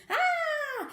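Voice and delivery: loud high ah; sudden gleeful squeal; high excited cry. Acted. Recap of noises.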